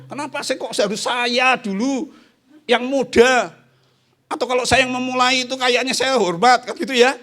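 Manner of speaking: put-on high, loud whiny young person; mocking youth voice. Preacher mimics a young churchgoer, high and whiny. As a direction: whine like a put-out student.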